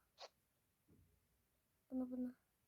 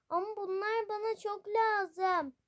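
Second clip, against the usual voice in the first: higher, louder pleading protest; frustrated whine; 7 semitones higher, 6 dB louder than usual. Real, not acted. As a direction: whine that you really need them. Upset that things will not fit.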